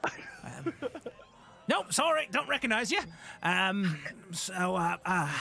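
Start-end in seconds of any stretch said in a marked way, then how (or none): 0.0-3.0 put-on high, creaky old goblin greeting; elderly goblin. Old goblin at the ticket booth greets the party, high and doddering.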